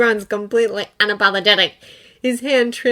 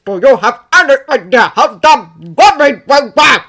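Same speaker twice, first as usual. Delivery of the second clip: furious scolding; 4 semitones higher, 10 dB louder than usual. A narrator's reading.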